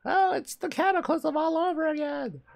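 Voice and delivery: high hammed-up cry; mock dramatic wail. Player hamming up doom over a repeat disaster. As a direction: wail it like a doomed villager.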